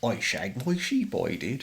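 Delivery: slow mock West Country yokel; lightly imitating a Somerset farmer; Bristolian accent. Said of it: "It's like".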